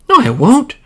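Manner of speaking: put-on high, loud little girl refusal; stubborn child saying no; child refusing. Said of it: "said the child".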